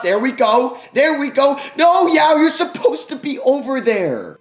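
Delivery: high, loud frantic shouting; excited yelling. Real, not acted. Gamer shouting at his player Yao, frantic excitement. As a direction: lose it as the play breaks down. Frantic reaction during game.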